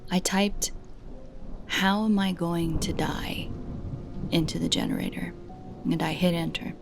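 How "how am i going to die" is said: ordinary delivery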